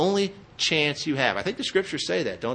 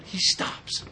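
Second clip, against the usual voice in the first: whisper; 4 semitones higher, 6 dB quieter than usual. Acted. Dramatic pause in telling the story.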